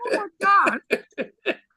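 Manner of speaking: high and very slow, drawn out in dismay; mortified. Retold or imitated. Recalling meeting [name], high slow mortified groan. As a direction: stretch it out, squirming. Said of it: "I was so embarrassed".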